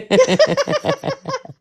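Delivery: high burst of laughter; real high-pitched laugh. Real, not acted. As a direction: crack up at your own joke. Joking about threatened good time.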